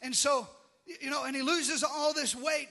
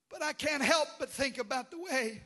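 ordinary talk

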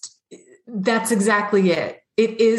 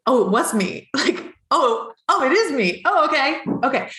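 higher, delighted dawning realization; a light, amused sense of discovery; surprised realization; 6 semitones higher than usual. Retold or imitated. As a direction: let it dawn on you with a laugh. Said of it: "you're going".

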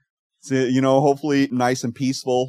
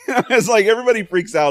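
laughing; 11 semitones higher, 8 dB louder than usual. Real, not acted. Laughing through the retelling.

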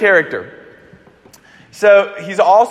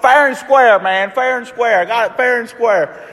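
higher-pitched, indignant retort; mock defensive insisting; 6 semitones higher than usual. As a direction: imitate a sore winner standing his ground. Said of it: "gets defensive".

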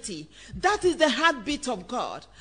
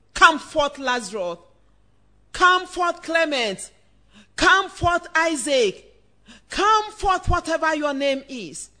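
ringing, louder call, each name dropping after; loud summons; 6 dB louder than usual. Acted. Preacher calling listeners out like Lazarus, loud summons. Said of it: "he called with a loud voice".

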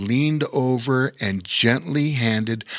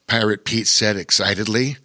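ordinary delivery, nothing marked

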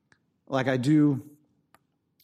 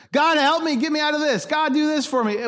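high, loud, pleading cry to God; mock panicked prayer, pitched high; desperate foxhole plea; 11 semitones higher, 4 dB louder than usual. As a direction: beg like you're under fire.

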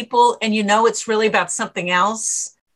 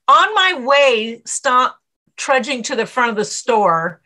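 normal talking voice

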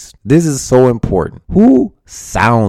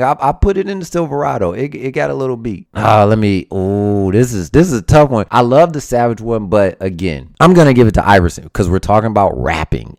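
usual speaking voice

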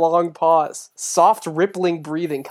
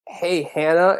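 ordinary delivery

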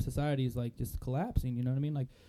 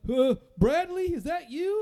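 much higher, loud, slow, dazed voice; cracked-out bum; 7 dB louder, slower than usual. Retold or imitated. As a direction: call up hopefully, out of your mind. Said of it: "And he was like".

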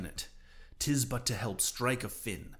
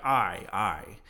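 plain reading voice

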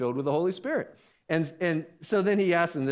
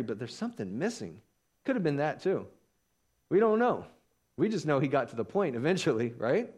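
ordinary talk

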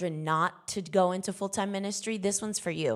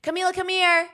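high, louder call to come here; 9 semitones higher, 5 dB louder than usual. Retold or imitated. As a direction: call her over, still patient. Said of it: "This is how it happens at my house".

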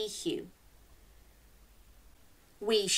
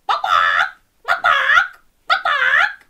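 loud clucks, high then higher; clucking chicken; 12 dB louder than usual. Shown as a demonstration. Host clucks like a chicken for the children, loud and high. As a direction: cluck like a hen. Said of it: "Can you cluck like a chicken".

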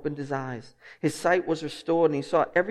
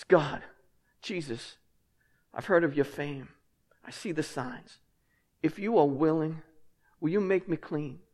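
soft, humble, broken plea; desperate plea; 2 semitones lower, 7 dB quieter than usual. Pretend. Leper begging Jesus for healing, quietly desperate. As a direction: beg quietly as if your life depends on it. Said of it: "hear the desperation in this man's voice".

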